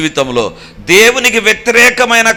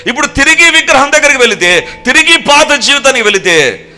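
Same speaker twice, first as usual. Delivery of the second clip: higher and louder, driving sermon delivery; fiery preaching; 6 semitones higher, 4 dB louder than usual. Acted. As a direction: preach it hard to the congregation. Preaching at full force.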